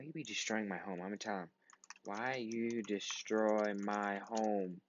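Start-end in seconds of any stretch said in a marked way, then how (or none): 2.0-4.8 slow, drawn-out accusing question; slow reproachful plea; slow mock plea. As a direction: stretch each word out like a wounded kid.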